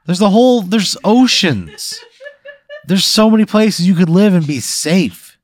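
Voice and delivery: high, loud incredulous protest; worked-up, pleading complaint; exasperated rant. Acted. Player argues with the monsters.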